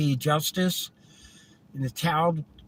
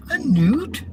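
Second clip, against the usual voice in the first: mock disbelief; 9 semitones higher than usual. Acted Monty Python scene.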